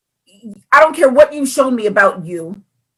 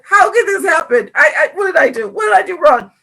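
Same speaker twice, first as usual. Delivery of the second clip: high, hammed-up self-pity; imitated fretful whine; mock anguish; 7 semitones higher than usual. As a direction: wail it like someone tormenting themselves. Mocked self-torment quoted.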